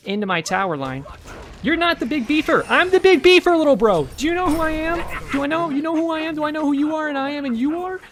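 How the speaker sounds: loud, high hype-man taunting; hyped-up gloating at the enemy player; excited trash talk. Real, not acted. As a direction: bellow it like a cocky tough guy. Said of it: "I got really excited there".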